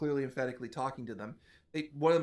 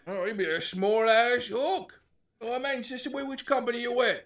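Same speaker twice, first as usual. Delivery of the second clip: hobgoblin voice; 10 semitones higher, 7 dB louder, slower than usual. Acted. GM hobgoblin voice continues.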